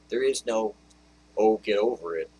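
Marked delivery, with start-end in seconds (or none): 1.4-2.3 mock brush-off, louder; imitating a careless comforter; hammed-up "get over it" shrug; dismissive platitude. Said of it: "kind of scripture for you".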